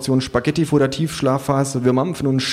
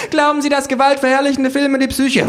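very high, loud questioning; shrill interviewer cut short; doomed pollster; 4 dB louder than usual. A narrator's reading. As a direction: shriek the survey question as the mower nears. Pollsters survey while being mown down.

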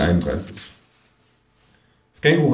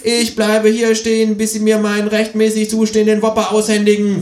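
kangaroo voice; 13 semitones higher, 4 dB louder than usual. Acted. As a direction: plant your feet and refuse to move. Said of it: "sagt das Känguru".

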